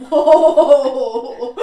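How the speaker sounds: loud laughing exclamation; laughing outburst. Real, not acted. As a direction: burst out laughing at yourself. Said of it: "laughing outburst".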